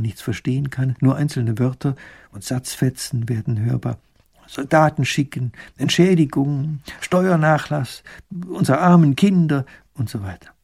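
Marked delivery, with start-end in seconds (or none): 4.5-9.6 mildly raised, higher crowd calls; crowd shouting. A narrator's reading. Villagers shouting demands in the castle yard, mock calls. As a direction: call out snatches like an unruly crowd. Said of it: "Sie brüllen alle durcheinander".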